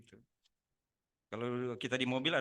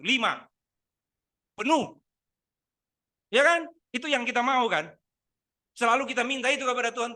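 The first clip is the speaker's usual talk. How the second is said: loud, high, driving sermon voice; full-force preaching; 9 semitones higher, 10 dB louder than usual. Acted. Pastor pressing the congregation, loud preaching. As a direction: preach it hard to the room.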